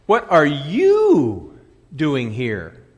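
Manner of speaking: slow question, leaning hard on YOU; stress on YOU. Shown as a demonstration. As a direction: draw out and lift the word you. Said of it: "depending on where you put the emphasis".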